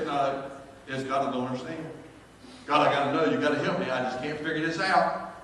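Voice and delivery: ordinary delivery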